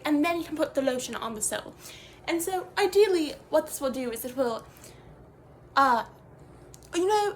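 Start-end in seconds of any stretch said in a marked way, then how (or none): none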